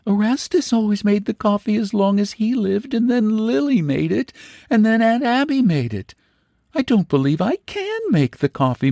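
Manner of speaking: put-on high, lightly surprised girlish voice; spoiled young woman puzzled by the empty kitchen; innocent young woman. A narrator's reading. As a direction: ask innocently. Luella helpless.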